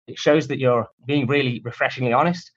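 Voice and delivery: usual speaking voice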